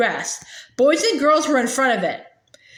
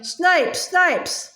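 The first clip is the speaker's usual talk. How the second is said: yelling taunt; 5 semitones higher than usual. A narrator's reading. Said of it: "All the boys were pointing and yelling".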